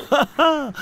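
high hearty neighing laugh; horse laughing. A narrator's reading. Narrator voicing the old horse's broad happy laugh. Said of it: "Był to śmiech szeroki, rubaszny, szczęśliwy".

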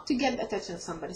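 ordinary delivery

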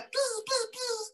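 very high fading buzz; mosquito buzz. Acted. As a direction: buzz round inside the head. Mosquito in lion's head.

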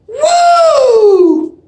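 high loud whoop with a laugh in it; cheer shout. Real, not acted. Fan retaking his cheer, loud laughing whoop=39. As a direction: whoop again, bigger. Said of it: "not good enough".

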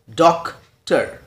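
"डॉक्टर" slowly as DOC, pause, TER; two separate beats on "डॉक्टर"; split into syllables. Shown as a demonstration. Teacher shows two syllables making one foot, sounded out slowly. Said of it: "दो syllables मिलकर एक".

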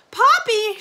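put-on loud, high call for a missing child; alarmed cry. A narrator's reading. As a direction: cry the name out sharply in alarm. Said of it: "she cried".

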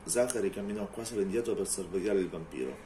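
ordinary talk, nothing marked

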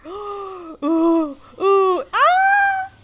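gasp, then high squeals building to a scream; escalating yelps of fright; panicked shriek. Real, not acted. Creature closing in.

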